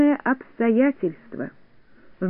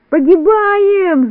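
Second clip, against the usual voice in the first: high, loud, long-drawn wail; wailing yell; 9 semitones higher, 6 dB louder, much slower than usual. A narrator's reading. Neznaika in the falling balloon before hiding, wailing yell. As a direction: wail it out at the top of your voice. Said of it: "завопил Незнайка".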